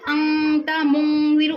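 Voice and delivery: chanted "अंता ... मुंजिरु" with the n held nasal; nasal ghunna. Shown as a demonstration. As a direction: draw the n out through the nose. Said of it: "गुन्ना करना है".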